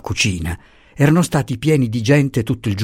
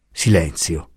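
ordinary delivery

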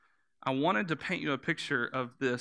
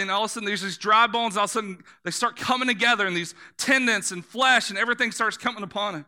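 higher, louder building preaching; 7 semitones higher, 4 dB louder than usual. Acted. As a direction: lift the congregation into the vision. Preaching at full force.

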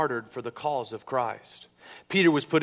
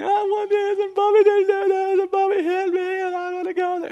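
very high, loud mock whine; whining child; 8 dB louder than usual. Daughter in trouble complaining to dad, hammed-up whining. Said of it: "she whines a lot".

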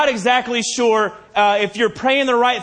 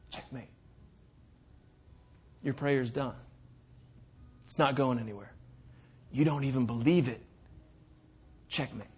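hushed, menacing whisper into the ear; enemy's whisper; 6 semitones lower, 10 dB quieter than usual. Acted. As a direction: breathe it low like a tempter. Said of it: "the enemy wants to whisper in your ear".

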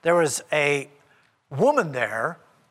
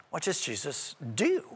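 ordinary delivery

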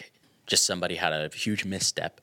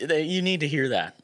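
emphatic; 9 semitones higher, 4 dB louder than usual. Real, not acted. Emotional emphasis.

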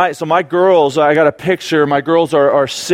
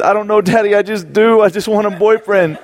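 lightly voiced child, pitched higher; a higher, imitated small-child plea; helpless little girl; 5 semitones higher than usual. Four-year-old daughter answering her dad, lightly imitated helplessness.

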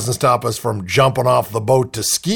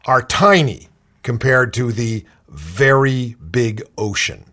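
ordinary delivery

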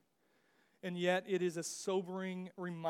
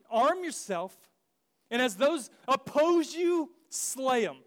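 commanding order; 4 semitones higher, 6 dB louder than usual. Retold or imitated. Moses commands the Levites.

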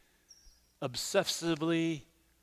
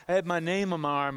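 ordinary delivery

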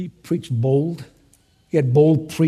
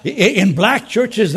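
ordinary delivery

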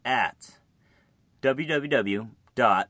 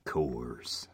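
whispered ad tag; 13 semitones lower, 11 dB quieter than usual. Retold or imitated. Imitating beer commercial.

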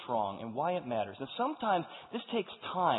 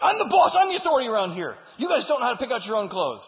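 hammed-up shrill shout, much higher and louder; bossy mom yell; 8 semitones higher, 11 dB louder than usual. Controlling mom yells at her teenage sons about their clothes, mock shout. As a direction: bark orders like a controlling mother. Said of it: "she'd be like".

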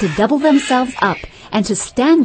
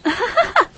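high, bubbling giggle; helpless girlish giggling; bursting out laughing. A narrator's reading. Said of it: "she could not help bursting out laughing".

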